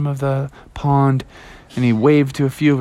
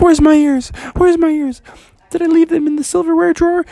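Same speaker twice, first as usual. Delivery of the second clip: very high, loud frantic squeal; crazed panic; 4 dB louder than usual. Acted. Froggy runs round the house hunting his ears, frantic. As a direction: shriek it like a frantic little frog. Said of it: "he started running around the house crazy".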